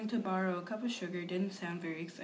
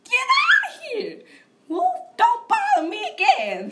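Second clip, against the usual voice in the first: loud, much higher squeaky pig shout, slow; rude pig shout; 13 dB louder than usual. Retold or imitated. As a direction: bark at the wolf in a shrill pig voice. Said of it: "that rude little porker answered".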